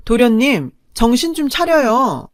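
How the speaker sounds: loud, slow scolding call; stern rebuke. Retold or imitated. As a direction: drag out each word to shake him awake. Scolding brother-in-law to wake up.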